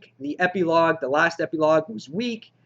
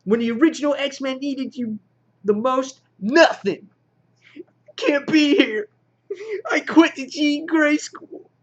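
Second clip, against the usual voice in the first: high, louder mock whining; hammed-up tantrum, pitched way up; whiny baby; 9 semitones higher, 4 dB louder than usual. X-Man quitting the school in protest, mock whiny outburst. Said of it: "She's like a little whiny baby here".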